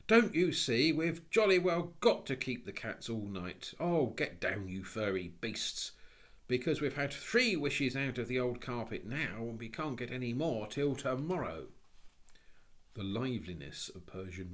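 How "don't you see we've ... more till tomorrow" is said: loud, raised-pitch exasperation; schoolboy scolding impatiently; vehement, fed-up explanation; cross boy. Acted. Boy crossly explains to his sisters.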